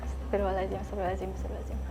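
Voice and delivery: hushed, frightened muttered prayer; scared whisper-quiet. Real, not acted. Alone at night, hushing prayers in fear, softly. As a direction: breathe the words softly, terrified. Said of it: "Takut, takut".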